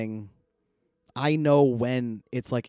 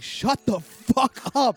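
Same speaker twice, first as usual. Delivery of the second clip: high-pitched, slow re-enacted scream; retold scream; 12 semitones higher, 4 dB louder, slower than usual. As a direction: stretch out each word of a re-enacted outburst. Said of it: "Like, I screamed".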